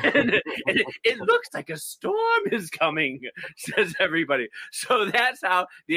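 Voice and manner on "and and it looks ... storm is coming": much higher, laughing through a hammed-up eerie whisper of dread; mock spooked. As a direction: send up the soap characters sensing evil, giggling. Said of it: "all the other characters are like".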